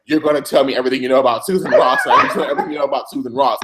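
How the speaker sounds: fast, laughing grilling of the prisoner; mock interrogation. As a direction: rattle it off, pressing him hard. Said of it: "She's like".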